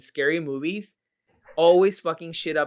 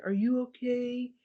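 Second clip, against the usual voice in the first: put-on high, slow, timid kid voice; a scared little boy asking softly; boy's timid voice; 10 semitones higher, 4 dB quieter, slower than usual. A scared boy asks the crying woman, in a high timid voice. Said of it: "he's like".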